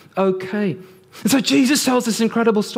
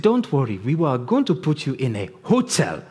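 mock Nigerian accent; 8 semitones lower, faster than usual. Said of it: "not such a stereotypical bad accent".

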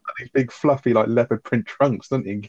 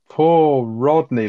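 louder, higher, very slow imitation of pity; mock pity; 5 semitones higher, 5 dB louder, much slower than usual. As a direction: Dale milking sympathy from Raquel, slow mock lament. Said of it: "He's like".

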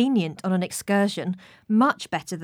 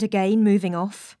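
plain reading voice

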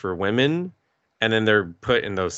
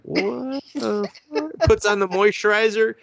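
stunned disbelief; 11 semitones higher than usual. Retold or imitated. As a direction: let the shock stretch out high. Seeing the giant man face.

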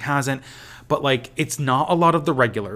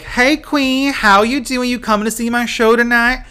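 much higher and louder, campy Southern flourish; campy Leslie Jordan; 11 semitones higher, 7 dB louder than usual. Retold or imitated. Leslie Jordan greets a fan on the street, high campy voice. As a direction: greet a fan like a flamboyant star.

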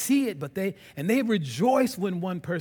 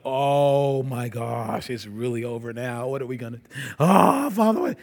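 dismayed angels; 4 semitones lower, 6 dB louder than usual. Retold or imitated. Angels seeing Adam grab the fruit, rising alarm. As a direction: build from stunned to frantic. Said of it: "the angels were like".

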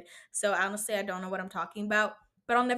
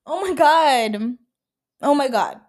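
loud, drawn-out mock "oh my God"; dramatic outburst; 4 semitones higher, 9 dB louder, slower than usual. Mocking dramatic customers, hammed-up exasperation. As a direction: imitate an over-dramatic complainer, loud and higher. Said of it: "people are so dramatic".

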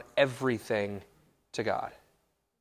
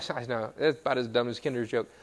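usual speaking voice

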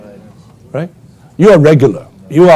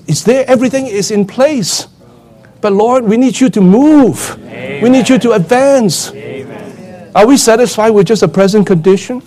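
fiery preaching; 6 semitones higher than usual. Acted. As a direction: preach it with the fire building. Preacher urges the saints.

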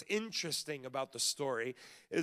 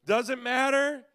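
normal talking voice

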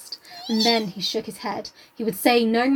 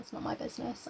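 whispered aside; 14 dB quieter than usual. Retold or imitated. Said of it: "was kind of been like".

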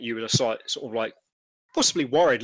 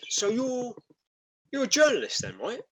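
lightly voiced, higher-pitched sceptical trader; sceptical stallholder; 11 semitones higher than usual. Stallholder doubting the reporter who walked up, sceptical. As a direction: question the newcomer with mild suspicion. GM voices suspicious market worker.

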